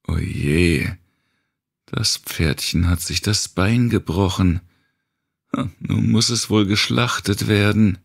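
false regret. Acted. The grinning tormentor mocks the mother's broken leg, in false sympathy. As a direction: pretend to pity while relishing every word. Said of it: "Mit falschem Bedauern in der Stimme".

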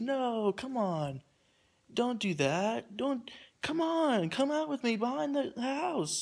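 high, drawn-out mock coaxing; imitated pleading. Stranger luring him behind the house, imitated slow high pleading.

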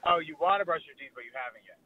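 usual speaking voice

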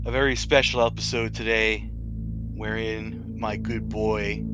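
ordinary talk

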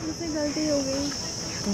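tearful apology. Acted. Girl caught, admitting fault.

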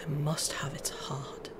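whispered, sinister wicked queen. Retold or imitated. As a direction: breathe it like a scheming evil queen.

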